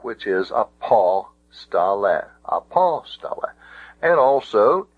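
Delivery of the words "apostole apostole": apostole accented on the "pos"; stress on third-last syllable. Shown as a demonstration. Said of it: "accent can go back to the third syllable".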